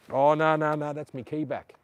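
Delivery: loud real startled oh no; alarmed oh no. Real, not acted. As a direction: suddenly catch the dangling key. Realises his car key is hanging out.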